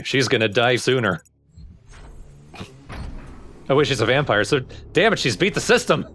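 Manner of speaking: usual speaking voice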